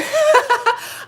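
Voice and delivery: high, loud burst of laughter; real high-pitched victory laugh; triumphant cackle. Real, not acted. Thrilled the advice worked.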